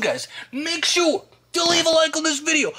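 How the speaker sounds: high, bright-eyed plea for likes; eager youtuber hype; hyped plea. Acted. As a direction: beg for the like like it's the best news ever. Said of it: "Super important".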